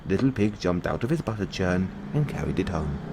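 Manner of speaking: ordinary delivery, nothing marked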